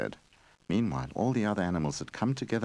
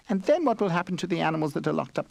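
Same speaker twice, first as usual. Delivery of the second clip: squeaky mouse; 11 semitones higher, faster than usual. Acted. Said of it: "the mouse squeaked".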